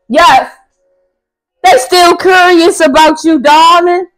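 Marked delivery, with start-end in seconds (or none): none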